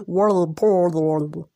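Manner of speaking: slow, odd-timbred repeat; mock your own strange drawl; mimicking own slow delivery. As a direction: World portal dragged out slowly in a put-on voice. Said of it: "why did I speak like that".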